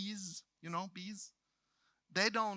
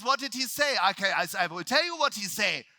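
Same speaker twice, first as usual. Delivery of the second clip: put-on loud, high retort in broken German-accented English; indignant, heavy German accent; agitated German-English; 4 semitones higher, 9 dB louder than usual. Speaker answering lead trainer's question, agitated German-accented English. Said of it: "like Jinglish".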